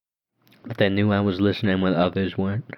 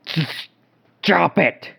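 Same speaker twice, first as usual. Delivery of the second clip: high, louder shout; 10 semitones higher, 4 dB louder, much slower than usual. A narrator's reading. Narrator shouting at the ghost girl throwing things, high and loud. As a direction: yell at the spirit to stop. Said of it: "I shouted".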